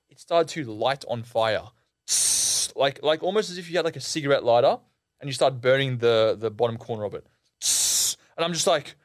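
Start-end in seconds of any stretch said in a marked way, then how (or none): 2.1-2.7 sharp sustained "tss" of burning; imitated sizzle. Storyteller imitating the t-shirt starting to burn, sizzling hiss. 7.6-8.2 sharp sustained "tss" of burning; imitated sizzle. Storyteller imitating the t-shirt starting to burn, sizzling hiss.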